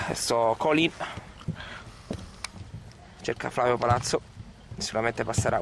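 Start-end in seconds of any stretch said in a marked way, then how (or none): none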